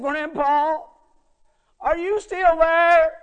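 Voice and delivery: high, loud, drawn-out worried call; quivering child's call. Retold or imitated. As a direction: imitate an anxious little boy calling out for grandpa. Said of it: "he had a little quiver in his voice".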